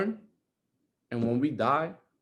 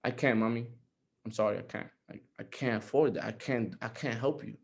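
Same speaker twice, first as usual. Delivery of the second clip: softer and lower, a pained confession; imagined apology to daughter; 5 semitones lower, 9 dB quieter than usual. Pretend. Father imagines telling his daughter he can't help, softly ashamed. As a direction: say it like it hurts to admit. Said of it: "I can't picture myself telling Dadiana".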